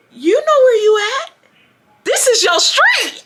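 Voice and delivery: high-pitched mock disbelief; incredulous challenge. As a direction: call out the liar in a high imitated voice. Retold lady challenging the excuse.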